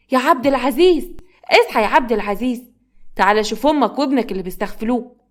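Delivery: indignant wife calling out; calling loudly. Acted. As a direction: summon your sleeping husband in alarm. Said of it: "وبتفضل تنادي على جوزها بصوت عالي".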